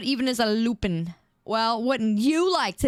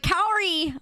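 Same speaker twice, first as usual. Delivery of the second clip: people calling out; 11 semitones higher than usual. Retold or imitated. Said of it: "People like".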